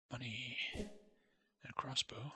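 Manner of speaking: slow hushed whisper while looting; whispered aside. Real, not acted. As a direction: breathe it out slowly.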